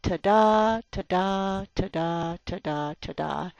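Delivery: ta-da chanted with the stress on da, fading out; drum beat rhythm. Shown as a demonstration. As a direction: beat out ta-da, trailing off. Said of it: "everything goes along quite smoothly".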